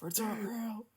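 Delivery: high, yelled protest slogan; hammed-up protester chant; mock street shout. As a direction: bellow it at passing cars like a protester. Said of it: "shouting it at the cars".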